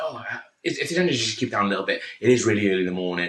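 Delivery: quick, hushed request; fast polite plea. Retold or imitated. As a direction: rush out a polite ask. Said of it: "I was just like".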